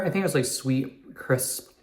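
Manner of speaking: talking with mouth full. Real, not acted. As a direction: speak around a bite of apple. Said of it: "Sorry, I'm talking with my mouth full".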